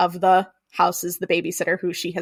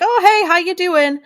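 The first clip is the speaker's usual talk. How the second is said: lightly voiced old neighbor, pitched up; a higher, friendly hail across the mailboxes; folksy neighbor greeting; 10 semitones higher, 4 dB louder than usual.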